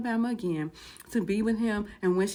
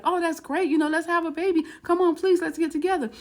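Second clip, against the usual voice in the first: higher, delighted coaxing; eager pleading; 7 semitones higher, 4 dB louder than usual. Retold or imitated. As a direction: coax excitedly with the pitch held up. Man delighted, pleading to reunite.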